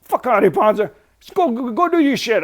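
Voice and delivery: very high, very loud sputtering shout; too furious to get the words out; pissed-off stuttering yell. Retold or imitated. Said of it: "He's so pissed off".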